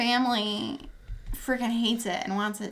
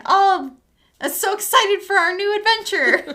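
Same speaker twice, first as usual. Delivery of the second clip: cheery boggart; 9 semitones higher, 7 dB louder than usual. Retold or imitated. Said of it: "hear something ... say".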